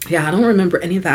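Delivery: rushed, brushing it off; fast dismissive. Retold or imitated. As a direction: toss it away quickly. Quoting him casually.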